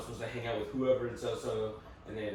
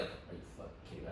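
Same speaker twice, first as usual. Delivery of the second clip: whispered disbelief; 4 semitones lower, 11 dB quieter, faster than usual. Retold or imitated. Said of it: "I'm just sitting there like".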